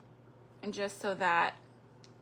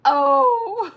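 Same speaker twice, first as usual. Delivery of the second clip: touched gasp; 9 semitones higher, 14 dB louder than usual. Retold or imitated. Touched grandma's emotional reaction.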